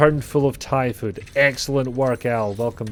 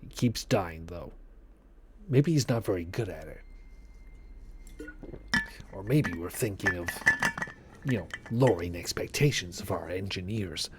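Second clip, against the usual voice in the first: slither the words out like a snake; 5 semitones lower, 8 dB quieter than usual. Pretend. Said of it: "Why did I go into a snake impression?".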